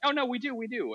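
high, light mom voice; cheerfully correcting her; a high voiced mom; high friendly neighbor. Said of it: "And she's like".

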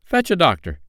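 high, firm command; boy captain's crisp order. A narrator's reading. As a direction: send someone off on an errand. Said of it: "he ordered".

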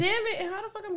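sudden high outburst at the hail; surprised exclamation. Real, not acted. Friend sees hail falling, a high surprised outburst. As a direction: blurt it out high, caught off guard.

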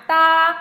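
high, level, loud "Da"; first tone. Shown as a demonstration. Teacher running through four tones on one sound, "Da" high and level. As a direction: hold "Da" high and flat. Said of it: "One more time".

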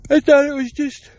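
mid-yawn, words pulled open. Real, not acted. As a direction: say it while yawning. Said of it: "I keep yawning".

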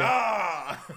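high, drawn-out "ah"; mock exclamation after a feat; imitated cry. Man opening beers with his eyes, crying out.

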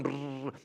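lip-trilled engine drone; propeller buzz. Retold or imitated. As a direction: imitate the plane rattling along. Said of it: "And we're going".

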